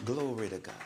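very soft, low-pitched praise; quiet test phrase. Retold or imitated. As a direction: say it low to test their hearing. Testing deaf woman with quiet words.

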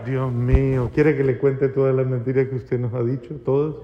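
low, soft muttered comeback; réplica del hijo. Acted. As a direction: grumble back under your breath, acting the child. Hijo responde por lo bajo. (réplica refunfuñada, grave y bajita)

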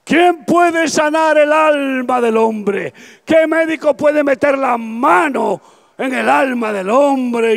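high, slow, ringing shout; voicing the psychiatrist friend who found Jesus, high preaching shout. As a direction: proclaim it like a fiery preacher. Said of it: "Y él me decía".

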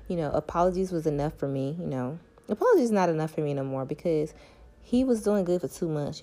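normal talking voice

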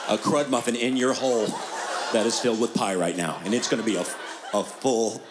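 low, hammy tough-guy threat; mock threat. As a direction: growl it like a cranky old man.

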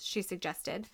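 plain reading voice

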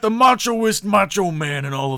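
high, loud, hyped wrestler promo; Macho Man impression. Showing off his Macho Man to a friend. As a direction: sell it like a cocaine-fuelled wrestler. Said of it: "That's actually pretty good".

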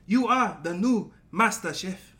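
slow, loud British chef proclamation; Gordon Ramsay. Shown as a demonstration. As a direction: announce a winner as a British TV judge. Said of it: "this is my Gordon Ramsay".

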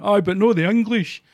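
high, loud mocking MP voice; imitating the MP. Quoting the Conservative MP, mocking. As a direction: sneer it out as the Tory MP. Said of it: "this Conservative M P went".

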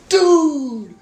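loud, high, drawn-out "dude" yell; drunken holler. Retold or imitated. Bar manager imitating drunk friend at the bar, hollering. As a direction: holler it long like a hammered buddy. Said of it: "and you just went".

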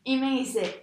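ordinary talk, nothing marked

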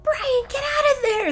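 high, loud, drawn-out scream; hammed-up horror-movie scream; screamed warning. A narrator's reading. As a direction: shriek a warning down the line. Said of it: "screamed Trisha".

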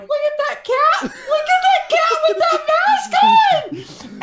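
high-pitched squeal through laughter; helpless laughter. Retold or imitated. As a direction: squeal it out, unable to stop laughing. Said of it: "I couldn't stop laughing".

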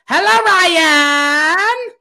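high, slow drawn-out sing-song greeting; sing-song hello. Retold or imitated. Caregiver on speakerphone greeting Ryan, slow high sing-song.

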